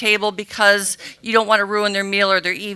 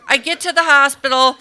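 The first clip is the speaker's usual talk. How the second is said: ordinary talk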